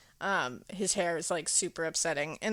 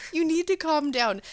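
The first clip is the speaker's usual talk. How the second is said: exasperated scolding; 8 semitones higher, 5 dB louder than usual. Retold or imitated. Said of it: "I was like".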